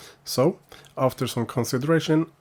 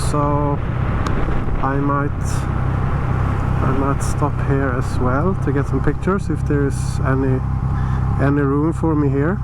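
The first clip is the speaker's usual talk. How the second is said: muffled helmet mic, louder voice; talking through a motorcycle helmet; inside a helmet; 5 dB louder than usual. Real, not acted.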